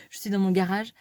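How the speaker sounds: ordinary delivery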